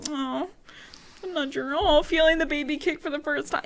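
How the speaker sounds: cooing at cuteness. Acted. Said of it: "I think it's cute".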